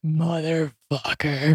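drawn-out, exasperated curse; slow, fed-up muttered swear; slow frustrated curse. Retold or imitated. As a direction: drag the swear out slowly. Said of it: "And I was like".

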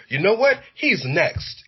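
high-pitched, sure he's next; excited prediction. Retold or imitated. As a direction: announce it with eager certainty. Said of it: "you're like".

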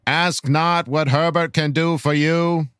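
put-on measured, old-fashioned presidential speech delivery; grand political orator. Reading a fan's rallying question to Charger Nation, grand oratory. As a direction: deliver a solemn, rousing address with dropped R's.

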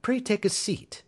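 airy, high-pitched courtesy; bland politeness. Acted. Holmes offers the intruder a seat, bland politeness. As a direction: offer it lightly, pitched well up. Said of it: "said Holmes blandly".

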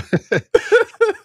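high delighted laugh; big burst of laughter. Real, not acted. Still reacting to the question.

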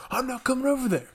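high-pitched mock rebellious kid; retold defiant refusal. Rebellious boy refuses the counselor, high imitated defiance. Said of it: "starting to form a rebellion".

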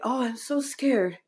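small, high, slow frightened voice; retold fear. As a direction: confide fear to a parent, acted in a high pitch. Retold frightened plea to her father.

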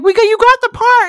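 high, loud good-news call; mock excited agent. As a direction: announce it big, like an agent phoning. Voicing agent calling with good news.

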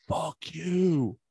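high, drawn-out mock yell; retold group yell. Three boys yelling at a passing van, mock yell. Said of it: "yell out in unison as loud as they can".

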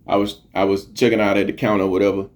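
ordinary talk

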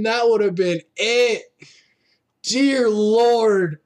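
lively live play-by-play calling; wrestling commentator. Real, not acted. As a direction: call the match as it happens.

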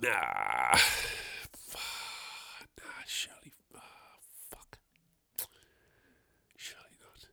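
hushed horrified whisper; whispered dread. Retold or imitated. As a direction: whisper it in disbelief. Panicked inner thoughts.

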